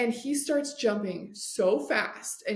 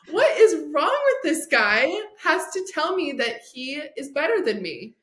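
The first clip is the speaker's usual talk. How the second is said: incredulous, louder and higher; what is this guy doing, higher and louder; exasperated disbelief; 6 semitones higher, 4 dB louder than usual. Retold or imitated. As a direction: vent at him in mock outrage. Exasperated inner thought about husband.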